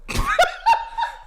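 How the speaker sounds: very high burst of laughter; real high-pitched laughing fit; laughing hard. Real, not acted. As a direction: crack up at the punchline. Cracks up at punchline.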